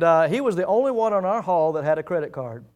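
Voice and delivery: ordinary talk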